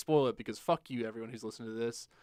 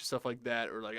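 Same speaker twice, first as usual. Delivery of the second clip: ordinary talk, nothing marked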